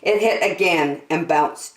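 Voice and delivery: plain reading voice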